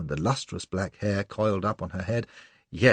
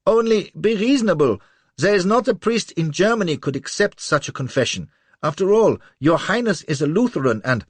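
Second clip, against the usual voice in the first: high, loud, anxious reasoning; pleading little man; 11 semitones higher, 7 dB louder than usual. A narrator's reading. Little man pleading with Lola, high and anxious. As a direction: reason with her in panic. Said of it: "cries the little chap".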